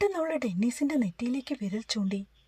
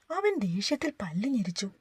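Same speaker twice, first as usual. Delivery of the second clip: usual speaking voice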